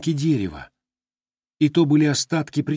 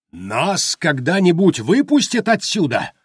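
high, loud piercing wail; piercing yell; 5 semitones higher, 4 dB louder than usual. A narrator's reading. Harper Baldwin screaming to be released, piercing yell. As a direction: shriek the question. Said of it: "пронзительно завопил Харпер Болдуин".

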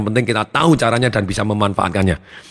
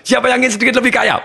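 high, loud showman greeting; booming high welcome to the crowd; seminar host's opening; 12 semitones higher, 3 dB louder than usual. Retold or imitated. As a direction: open the seminar like a big stage host. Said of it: "Persis seperti saya pada waktu buka seminar".

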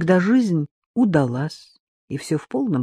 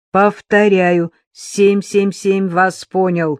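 crisp radio call; 2 semitones higher, 4 dB louder, slower than usual. Acted. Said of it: "чётко произнёс".

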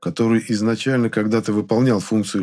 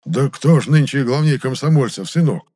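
put-on old man taken aback, higher and louder; astonished old-timer's question; surprised, turning cross; 6 semitones higher, 6 dB louder than usual. A narrator's reading. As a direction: raise the pitch in indignant surprise. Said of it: "удивился дед и внезапно рассердился".

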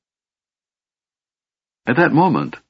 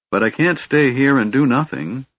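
usual speaking voice

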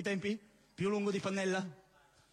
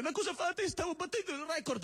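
put-on boy protesting; 6 semitones higher than usual. Young man protesting.

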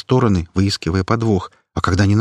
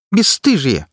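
indignant shout; 8 semitones higher, 4 dB louder, slower than usual. Acted. Activist shouting after them.

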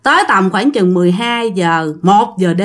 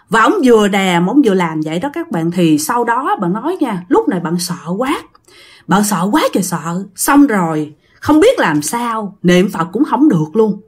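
plain reading voice

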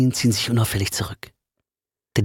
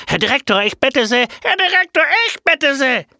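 shrill, much higher, loud outraged yell; furious yelling teacher; 5 dB louder than usual. Acted. The teacher yells at his double, much higher and louder. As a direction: bellow insults at the impostor. Both appeal to the director.

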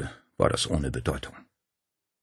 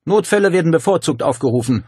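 higher, louder curt rasp; snarling housekeeper; 10 semitones higher, 7 dB louder than usual. A narrator's reading. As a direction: brush them off coldly. Same woman brushing them off.

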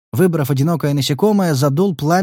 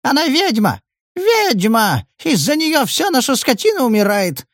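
falsetto wail; 11 semitones higher than usual. A narrator's reading. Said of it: "Неожиданно фальцетом заголосил мужчина".

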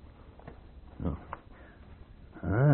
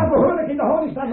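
barked order; 12 semitones higher, faster than usual. Acted. Tsar barks at officer.

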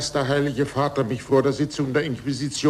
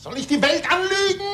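indignant cry; 12 semitones higher than usual. Acted. Scientist defends truth, pleading.

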